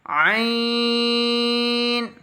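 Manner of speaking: "आइन" squeezed from the throat, held on a high note. Shown as a demonstration. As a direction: chant "आइन" long from the upper throat. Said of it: "आवाज़ लाजमी निकालनी है". ("आइन" हलक से, लंबा खींचकर)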